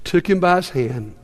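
soft, low, hushed narration; tender storytelling with gentle pauses. A narrator's reading. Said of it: "What a tender moment".

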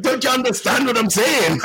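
high-pitched mock-exasperated Scottish priest; imitated Scottish priest. Imitating a hard-to-understand priest to the co-host, exasperated. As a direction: imitate a priest baffled that nobody follows him. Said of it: "there's a Scottish priest up there".

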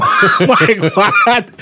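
high laughing "What?"; laughing shock. Real, not acted. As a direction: crack up in disbelief.

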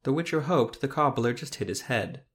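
ordinary delivery, nothing marked

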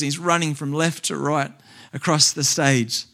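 ordinary talk, nothing marked

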